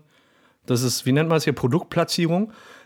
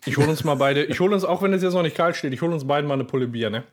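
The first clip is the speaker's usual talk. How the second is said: ordinary delivery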